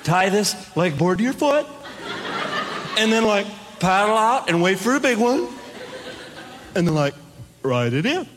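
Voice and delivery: lightly voiced California surfer; easygoing surfer explaining, half-stoned; surfer dude voice. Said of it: "He said".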